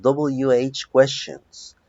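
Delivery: W H spelled out slowly; English letters. Shown as a demonstration. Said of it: "como le llamamos en inglés". (letras W H dichas despacio)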